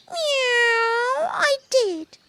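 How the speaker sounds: squeaky pleading kitten, high pitched. Acted. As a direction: beg like a little kitten. Kitten replies.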